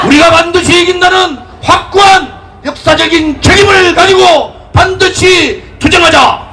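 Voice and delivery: impassioned political rally speech; roaring rallying speech. Acted. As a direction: rouse the crowd at full force.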